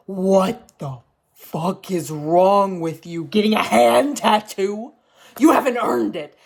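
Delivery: loud, slow outraged yelling; angry Reddit rant. Retold or imitated. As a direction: rant like a furious online stranger. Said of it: "everybody on Reddit was like".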